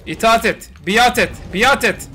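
commanding shout. Real, not acted. Commanding the dinosaur to submit.

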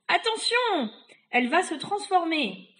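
high, loud warning cry; urgent warning. Acted. Warning that the witch will transform, high urgent shout. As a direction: shout out the danger.

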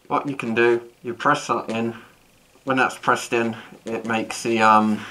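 muffled behind face mask; speaking inside respirator; through a gas mask. Real, not acted. Seen 2: Full-face gas mask worn in every frame; reference frames show bare face.

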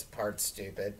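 ordinary talk, nothing marked

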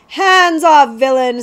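loud, high-pitched indignant snap; snapped order. A narrator's reading. Prince to outlaw reaching for basket, loud angry snap. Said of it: "snapped Prince Brat".

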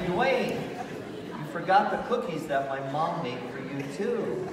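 high, loud call after someone. Retold or imitated. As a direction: hail them brightly, acting out the chase. Chasing Dwayne with cookies.

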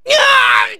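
high "aah" cry; pained yell. Acted. Fighter taking a hit, high yell. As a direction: yell out high as the blow lands. Character hurt in battle.

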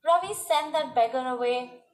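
ordinary delivery, nothing marked

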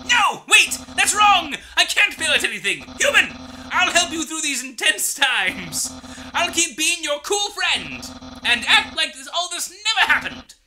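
loud, high, boastful skeleton chef; theatrical bragging skeleton shouting; Papyrus voice. Acted. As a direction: boom it out like a proud cartoon skeleton.

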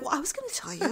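under his breath. Retold or imitated. The dentist, caught out, answering his patient under his breath. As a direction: mutter it sheepishly, half to yourself. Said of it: "below his breath".

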